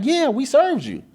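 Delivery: high, know-it-all insistence; mock smug agent. The agent insisting to him they served him, high and smug. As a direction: act the agent's smug certainty.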